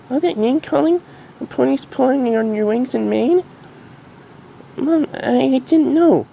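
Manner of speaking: very high, stammering filly voice; young filly close to crying; near tears. Acted. Dinky to her mom, close to tears. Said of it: "almost even shed tears".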